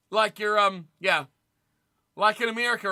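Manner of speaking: ordinary talk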